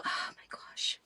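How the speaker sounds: breathless whisper of delight; awed whisper. Real, not acted. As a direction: whisper it like you're melting. Gushing over cute covers.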